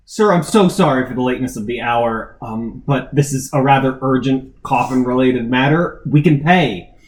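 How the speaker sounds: loud, earnest plea through a door; calling out. Acted. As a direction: raise your voice so he hears. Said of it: "Harmony will call out".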